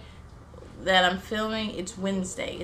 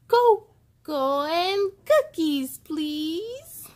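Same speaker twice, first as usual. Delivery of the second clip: put-on child ordering; 7 semitones higher, 4 dB louder, much slower than usual.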